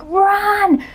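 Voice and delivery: high-pitched mock scream, drawn out slowly on the middle run; mock yelling at TV. Viewer yelling at a horror movie victim, high mock scream. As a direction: yell at the horror heroine to run. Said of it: "I don't actually scream at the T V".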